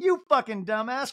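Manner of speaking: high-pitched retold scolding; mock insult. Crystal really flying at Garcelle, high mock scolding. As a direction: berate playfully, pitched well up. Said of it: "Crystal's, like, really flying".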